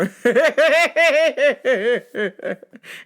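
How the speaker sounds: loud laugh falling away; real burst of laughter. Real, not acted. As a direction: laugh out at your own story. Laughing at his own story.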